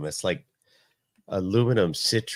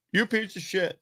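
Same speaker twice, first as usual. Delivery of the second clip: higher-pitched imitating insult; mock angry mother; 12 semitones higher than usual. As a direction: play the frantic mother, mildly hammed. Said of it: "And she's like".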